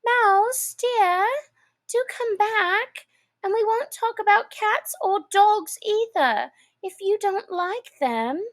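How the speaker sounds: high, gentle coaxing call; soft calling. A narrator's reading. Alice softly calls the fleeing mouse back. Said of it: "she called softly after it".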